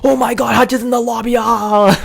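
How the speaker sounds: high, giddy fanboy squeal; so pumped. Retold or imitated. Fan telling friends his idol joined, so pumped. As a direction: reenact a boyish high-pitched freakout ending in a cry. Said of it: "I'm just so pumped".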